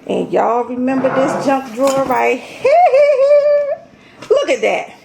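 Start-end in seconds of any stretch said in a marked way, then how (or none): 2.8-3.8 high laugh. Real, not acted. Showing off junk drawer.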